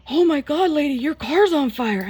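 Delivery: high, loud mock alarm; imitated shout of alarm; alarmed stranger. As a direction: cry out like a startled bystander. Retold stranger spots the fire.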